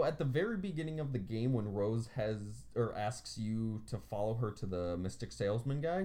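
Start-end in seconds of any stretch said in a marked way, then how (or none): none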